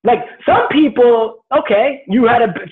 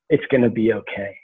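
hushed reassurance; 10 semitones lower, 6 dB quieter than usual. Retold or imitated. Host imagining the comforting line.

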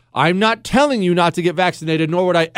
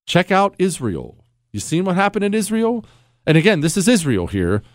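normal talking voice

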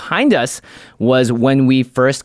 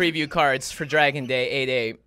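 ordinary talk

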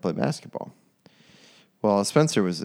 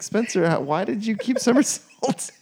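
incredulous question; 12 semitones higher than usual. Retold or imitated. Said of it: "I'm like".

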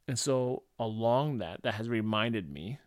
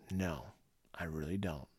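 inner thought; 6 semitones lower, 6 dB quieter than usual. Retold or imitated. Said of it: "I think to myself".

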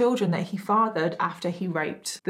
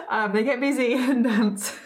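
laughing through words; 4 semitones higher than usual. Real, not acted. Laughing while retelling.

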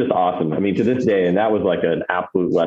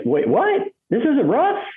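very high surprised question; shocked caller; slower than usual. Retold or imitated. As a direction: sound baffled and amazed. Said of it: "He's like".

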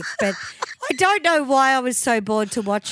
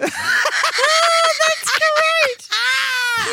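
high shrieking laugh through the words; delighted squeal; 4 dB louder, much slower than usual. Real, not acted. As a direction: squeal with delight, half crying.

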